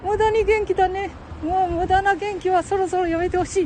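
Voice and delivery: high falsetto imitating his wife; wife's voice. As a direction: mimic the wife's high scolding voice. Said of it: "お母さんにね".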